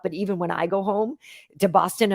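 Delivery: ordinary delivery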